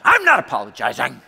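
loud mock-angry shout; defiant refusal. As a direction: shout down the demand to apologize. Heated refusal to apologize to wife.

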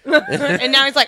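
usual speaking voice

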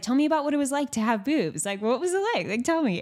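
mock therapist voice with a pitch that keeps shifting; imitating the therapist. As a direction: coax it out of her like an eager therapist. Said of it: "talking like that, and her voice is changing".

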